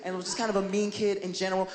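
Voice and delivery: ordinary talk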